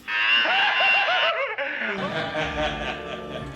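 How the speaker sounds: low creepy cackle; manic laugh. Acted. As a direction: laugh like a madman. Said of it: "Jack doing some prep work for his Joker role".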